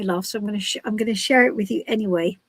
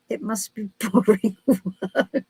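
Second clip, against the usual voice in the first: laughing through the words; slow, breaking into a laugh; amused; slower than usual. Real, not acted. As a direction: let a laugh take over mid-sentence. Amused reply to a chat comment.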